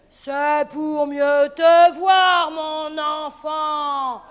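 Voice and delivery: very slow, loud wolf roar; wolf shout. Acted. Wolf roaring back at the girl. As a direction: roar it slowly.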